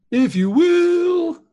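high, loud and very slow; dramatic drawn-out. Shown as a demonstration.

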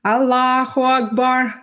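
briefly mimicked chant of a muezzin.

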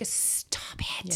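unvoiced whisper. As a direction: hiss it under your breath. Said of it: "I am like".

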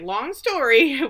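high, slow, drawn-out hedge; imitated sheepish evasion; awkward brush-off. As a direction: stretch it out high and loud. Retold awkward brush-off of question.

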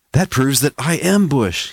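high, eager exclamation; excited. Acted. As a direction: blurt it out triumphantly. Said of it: "he said excitedly".

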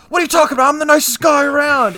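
high, loud indignant protest; shouted, high-pitched comic outburst; yelling. Retold or imitated. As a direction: holler it like a blustering sitcom hothead. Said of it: "they're always yelling at each other".

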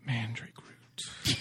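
slow, hushed spooky title; spooky whisper. Shown as a demonstration. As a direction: breathe it out slow and eerie. Dramatic title read.

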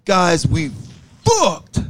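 high announcer-style intro; intro announcement. Shown as a demonstration. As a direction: belt out the intro. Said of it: "you gotta get the right tone".

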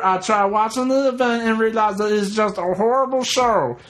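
high, eager letter-reading voice; reading Wilbur's email. Acted. Reading a listener's email aloud in a high silly voice. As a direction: read the fan email as a giddy guy. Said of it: "Wilbur says".

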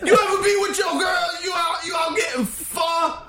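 high, shouty hammed-up standup voice. Pretending to perform at the Apollo, high shouty standup. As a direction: work the crowd like a brash Apollo comic. Does mock standup set.

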